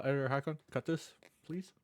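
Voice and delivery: ordinary talk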